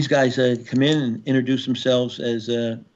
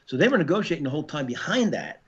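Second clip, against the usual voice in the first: ordinary talk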